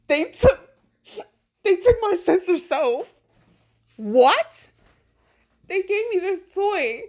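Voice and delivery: put-on high childlike complaint; high hurt voice. You telling God what they took, high and hurt. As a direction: whimper like a child tattling. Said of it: "And you're just like".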